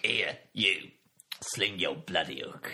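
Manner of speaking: mock-gruff Cockney deity; Cockney God. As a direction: order them out in a put-on East End growl. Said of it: "Whenever I do that Cockney voice".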